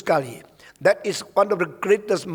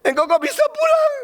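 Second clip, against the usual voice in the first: much higher, astonished cry; shocked delighted owner, high pitched; surprised owner; 10 semitones higher, 3 dB louder than usual. Retold or imitated. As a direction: squeal in disbelief at the returned dog. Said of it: "Dia kaget".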